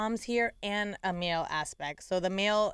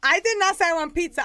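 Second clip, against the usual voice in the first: 1 indignant little son; 6 semitones higher, 5 dB louder than usual. Retold or imitated. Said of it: "He goes".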